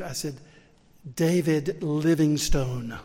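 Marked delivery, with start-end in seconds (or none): 1.1-3.0 slow and clear. Retold or imitated. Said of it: "I thought maybe it was my southern accent".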